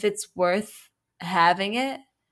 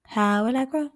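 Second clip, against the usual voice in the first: higher, rising plant question; pretend plant voice; 5 semitones higher than usual. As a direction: wonder aloud as a little plant. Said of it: "what a plant would do".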